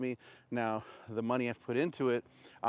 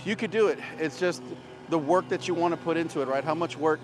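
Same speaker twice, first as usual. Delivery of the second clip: panting between words; winded talking while walking uphill; out of breath; 5 semitones higher, 5 dB louder than usual. Real, not acted. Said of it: "I'm out of breath".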